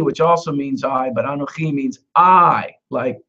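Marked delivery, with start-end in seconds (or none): 2.1-2.7 I punched loud with a fall; emphatic I. Shown as a demonstration. Teacher explaining what Anochi means, emphatic I. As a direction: hit I hard and let it drop. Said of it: "You know, it's emphatic".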